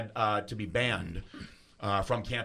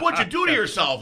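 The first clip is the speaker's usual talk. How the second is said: high, loud incredulous question; loud disbelief; 11 semitones higher, 9 dB louder than usual. Retold or imitated. Cop gawking at the injured student, loudly incredulous. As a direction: imitate a cop who can't believe it.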